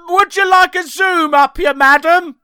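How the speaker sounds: high, loud, hammed-up shout; shouted catchphrase. Asked by the host, he shouts his famous line. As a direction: belt the famous line out like a street seller. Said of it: "Shout, shout your famous line".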